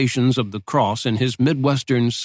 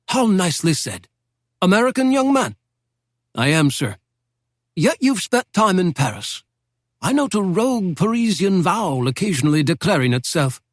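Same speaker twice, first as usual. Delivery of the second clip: put-on a lightly raised, genteel old don's voice; old British professor; 6 semitones higher than usual. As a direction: speak as a wry Oxford professor reminiscing. Professor questions the American.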